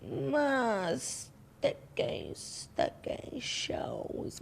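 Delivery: slow, breathy, drawn-out creepy voice; mock spooky whisper. Teasing her co-host with a slow creepy whisper. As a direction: stretch it out like a ghost story. Mocking repeat of co-host's words.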